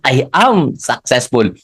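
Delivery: slow, deliberate "I am healthy"; a slow, heartfelt self-affirmation. Shown as a demonstration. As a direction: draw the affirmation out slowly. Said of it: "ilalagyan mo ng emotion".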